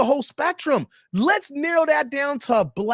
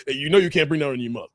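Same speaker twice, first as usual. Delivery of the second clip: low, fast fatherly warning; imitating his dad; 9 semitones lower, much faster than usual. Dad warns son off bringing her home, imitated low. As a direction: drop deep and rattle it off like dad. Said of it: "My dad would be like".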